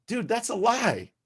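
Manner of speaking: a friend gently calling out the lie; NA's voice. Retold or imitated. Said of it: "And then N A goes".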